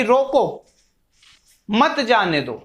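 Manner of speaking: रोको alone with a fall, pause, then मत जाने दो; pause after रोको. Shown as a demonstration. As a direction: break right after रोको, keep मत जाने दो together. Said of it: "के बाद". (रोको के बाद विराम, फिर मत जाने दो एक साथ)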